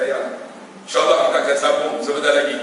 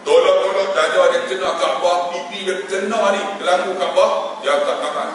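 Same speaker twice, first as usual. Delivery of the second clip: usual speaking voice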